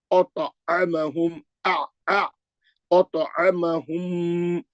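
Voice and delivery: "ओतो अमहुम" slow, heavy ط echoing with a bounce; qalqala on taa. Shown as a demonstration. Teacher demonstrates qalqala, thick and slow. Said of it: "खलखला करना है".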